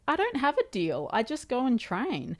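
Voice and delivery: high, protesting and a bit incredulous; indignant. Retold or imitated. Defending herself to gym guys.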